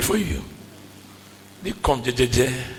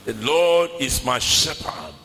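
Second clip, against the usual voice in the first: calm recitation; 6 semitones lower than usual. Acted. Calm slow recitation of psalm.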